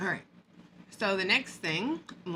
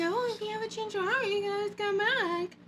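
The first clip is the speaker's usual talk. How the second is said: mocking sing-song; 8 semitones higher, 5 dB quieter than usual. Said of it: "So why is she saying".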